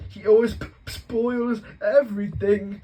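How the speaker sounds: loud, high whining wail; fake-cry for attention; pretend sobs. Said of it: "between huge pretend sobs".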